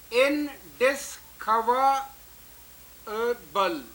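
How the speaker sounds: very slow इनडिस्कवरेबल broken into separate parts; syllable by syllable. Shown as a demonstration. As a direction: count out इनडिस्कवरेबल with a pause after each syllable. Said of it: "So this is one".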